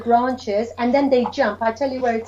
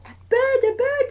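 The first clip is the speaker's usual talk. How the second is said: put-on much higher eager child voice; children's answer. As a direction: call it out like an excited toddler. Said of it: "they go".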